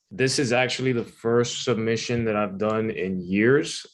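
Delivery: plain reading voice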